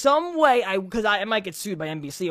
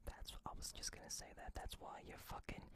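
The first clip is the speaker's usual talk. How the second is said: whispered under the breath; 28 dB quieter than usual. Real, not acted. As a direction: whisper it so only Jack hears. Hushed aside to co-host.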